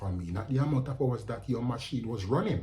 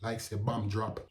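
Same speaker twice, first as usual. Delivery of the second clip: ordinary talk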